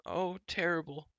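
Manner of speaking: Gurgi's miserable moan. A narrator's reading. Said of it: "moaned Gurgi".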